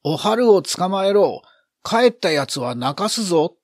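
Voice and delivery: put-on loud, high boy's battle cry; 叫ぶ号令. A narrator's reading. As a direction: shout the order and threat to the gang. 三公が飛び出して叫ぶ号令. (声を張り上げたガキ大将の号令)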